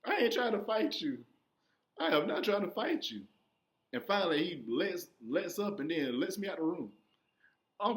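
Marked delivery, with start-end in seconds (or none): 0.0-3.2 higher, mock-tearful pleading; retold tearful protest; imitated crying. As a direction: plead like a crying kid, lightly acted.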